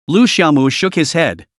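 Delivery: plain reading voice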